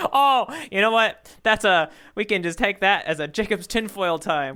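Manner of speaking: talking through real laughter; laughing. Real, not acted. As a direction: giggle through the words at your own joke. Laughing at own silly theory.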